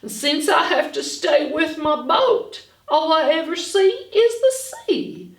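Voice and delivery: ordinary talk, nothing marked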